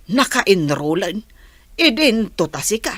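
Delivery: normal talking voice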